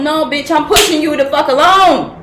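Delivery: 1 blunt retort. Real, not acted. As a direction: cut the coddling off hard. Snapping back after the mock voice.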